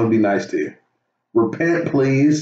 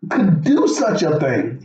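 high, slow, amazed question; stunned disbelief; 11 semitones higher, slower than usual. Retold or imitated. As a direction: gasp it out in wonder. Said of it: "You're like".

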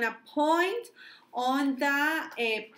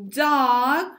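model word; 8 dB louder than usual. Shown as a demonstration.

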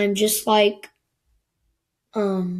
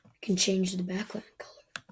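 whispering to self; 10 dB quieter than usual. Real, not acted. Muttering to self while fiddling.

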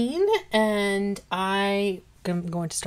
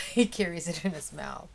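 ordinary delivery

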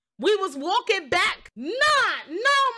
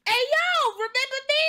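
mock crackhead greeting; 7 semitones higher than usual. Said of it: "she be looking at me like".

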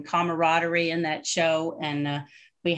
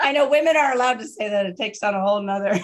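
fast, high laughing; 4 semitones higher, 6 dB louder, faster than usual. Real, not acted. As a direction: rush it out laughing.

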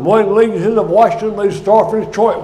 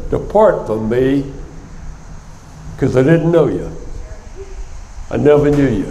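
saddest words; 6 semitones lower, 4 dB quieter than usual. Retold or imitated. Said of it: "reply with the saddest words in the Bible".

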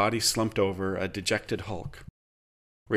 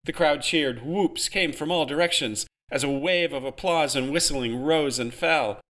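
ordinary delivery, nothing marked

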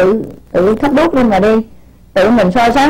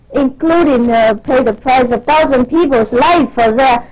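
normal talking voice

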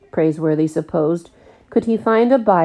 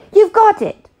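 very high, loud eager cry; bursting with the idea; excited; 6 dB louder than usual. A narrator's reading. Jack tells Praiseworthy he has it, high and thrilled.

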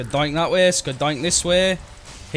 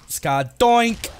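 hyped catchphrase; 8 semitones higher, 5 dB louder than usual. Pretend. Battle catchphrase repeated.

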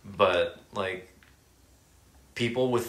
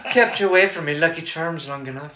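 mock Irish accent; 6 semitones higher than usual. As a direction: tease in a cartoon Irish brogue. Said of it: "I'm Irish. I can".

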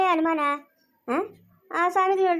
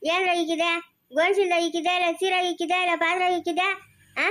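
ordinary talk

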